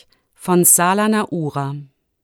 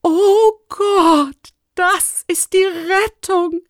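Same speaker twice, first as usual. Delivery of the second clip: very high, drawn-out, overcome cry; tearful relief; 12 semitones higher, 4 dB louder, much slower than usual. A narrator's reading. Rector seeing the nose drops, tearfully relieved. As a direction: wail it like a man saved. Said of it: "sagte er mit Tränen in den Augen".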